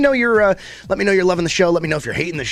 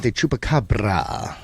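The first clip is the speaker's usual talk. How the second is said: slow, drawn-out Spanish accent, lower; 6 semitones lower, 4 dB quieter, slower than usual. Shown as a demonstration. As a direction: roll it out slowly in a Spanish accent. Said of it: "saying it with such a Spanish accent".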